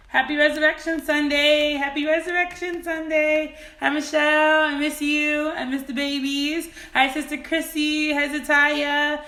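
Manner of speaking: bright calling out to the chat; excited greetings. Real, not acted. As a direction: greet each viewer with delight. Greeting viewers on livestream.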